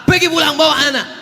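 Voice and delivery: high-pitched mock angry order; angry host. Host sending a mother and child home, mock angry. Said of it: "akhirnya tuan rumah marah".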